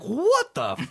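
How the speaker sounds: high, loud astonished squeak; stunned disbelief. Retold or imitated. Stunned seeing [name].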